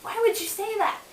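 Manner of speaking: a hammed-up offended snap back; mock hurt anger. Girlfriend retelling how she got mad at her boyfriend, mock indignant.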